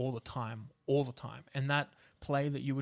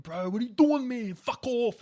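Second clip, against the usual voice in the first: higher, louder Western suburbs bro accent, hammed up; 10 semitones higher, 8 dB louder than usual. Actor demos his Western suburbs bro accent, loud and high. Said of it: "bro, like that kind of an accent".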